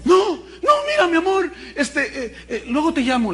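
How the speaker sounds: high, hurried brush-off to the lover; flustered husband. Acted. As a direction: get rid of her fast, flustered. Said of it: "le dice a la otra".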